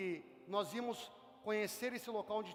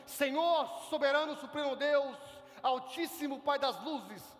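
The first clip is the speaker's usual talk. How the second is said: loud, grand, stiff address to God; mock-solemn titles, slowing at the end; overly formal prayer; 4 dB louder, slower than usual. Pastor mocks how people approach God, loud and pompous. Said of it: "nos achegar assim".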